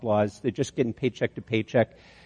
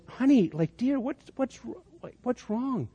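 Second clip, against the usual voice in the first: put-on much higher, hushed, gentle voice of a kind older woman; tender concern; 9 semitones higher, 7 dB quieter than usual. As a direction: coax softly, like comforting a crying stranger. Kind older woman comforts crying mom.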